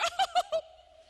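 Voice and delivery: high loud burst of laughter; demo laugh. Shown as a demonstration. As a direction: show them how to laugh it off. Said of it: "just go".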